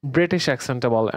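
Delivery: ordinary talk, nothing marked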